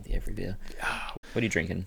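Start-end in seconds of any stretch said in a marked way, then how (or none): 0.0-0.6 "the Every Beer" murmured softer, in admiration; savoured and soft. Real, not acted. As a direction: repeat the name quietly, relishing it. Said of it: "God, it's a great name".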